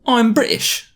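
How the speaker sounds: very high, hammed-up British accent; Russell Brand accent. British boy introducing himself, exaggerated British accent. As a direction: badly overdo a British accent. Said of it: "someone trying to do a Russell Brand accent".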